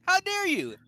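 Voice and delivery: high, loud mock indignation; hammed-up betrayed cry at the DM; mock outrage. Player scolding the DM in mock outrage.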